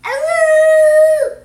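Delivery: loud, high wolf howl; howling like a wolf pup. Pretend. He's a little wolf himself.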